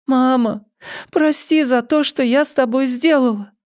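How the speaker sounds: put-on very high girlish apology; high, pleading daughter. A narrator's reading. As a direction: beg forgiveness in a high child's voice. Girl begs mother's forgiveness.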